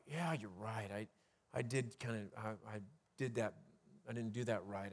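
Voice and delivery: low, soft voice of someone giving in to worry. Retold or imitated. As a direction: admit it quietly as if defeated. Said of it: "it sounds kind of like".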